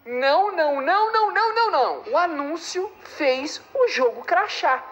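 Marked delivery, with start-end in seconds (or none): 0.0-2.1 panicked no. Real, not acted. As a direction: build into frantic despair. Panic as the game crashes.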